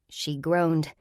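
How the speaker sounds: ordinary delivery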